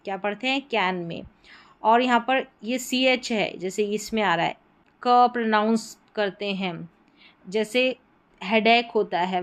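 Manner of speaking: ordinary talk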